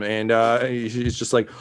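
ordinary talk

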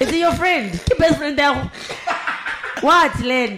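high, loud, laughing challenge; teasing interrogation. Real, not acted. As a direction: grill your friend in mock indignation. Teasing co-host about her ex.

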